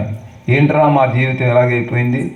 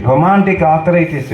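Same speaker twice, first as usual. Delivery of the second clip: normal talking voice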